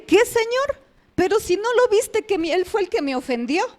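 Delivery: high, loud start, easing down; indignant protest. Retold or imitated. Wife protesting to God about her husband, indignant.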